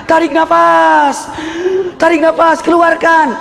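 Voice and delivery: nurse coaching breath. Retold or imitated. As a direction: call it out like a midwife coaching. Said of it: "susternya ini di samping".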